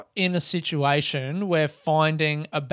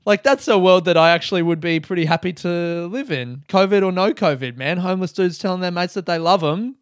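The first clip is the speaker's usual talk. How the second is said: usual speaking voice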